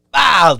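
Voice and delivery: loud, high vocal crash noise; mimicked impact. Cop lands knee-first on him, loud impact noise. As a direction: punch out a mock impact sound.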